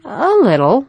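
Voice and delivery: high, light, brushing it off; little girl playing it down; trying to sound unconcerned. A narrator's reading. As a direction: hide the hurt with a breezy reply. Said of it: "tried to sound unconcerned".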